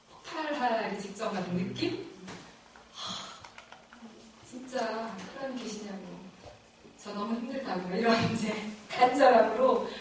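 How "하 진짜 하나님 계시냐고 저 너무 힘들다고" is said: hushed, pleading prayer to God; desperate quiet plea. Retold or imitated. A worn-out believer quietly begging God. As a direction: breathe out a weary, begging plea.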